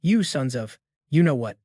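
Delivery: plain reading voice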